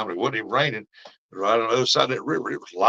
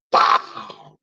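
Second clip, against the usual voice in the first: a loud, breathy burst for the lightning strike; imitated thunder crack; 7 dB louder than usual. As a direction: spit out the boom like a thunderclap.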